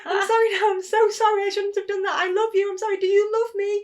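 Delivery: high, loud mock wailing apology; over-apologetic child. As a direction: beg frantically like a child after a tantrum. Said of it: "straight away I'd go".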